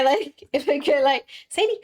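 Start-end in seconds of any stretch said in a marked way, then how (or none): 1.5-1.8 high sing-song call. Retold or imitated.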